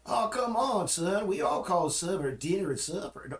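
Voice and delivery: put-on high, loud Southern accent; mock Southern mother. Mother correcting son on meal names, mock Southern accent. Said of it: "she had, had that affectation".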